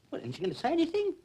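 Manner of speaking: high, fast, urgent complaint; indignant prodding. Acted. Prisoner urging defending officer to speak up, indignant. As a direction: egg him on, quick and high-pitched.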